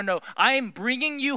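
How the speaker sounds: normal talking voice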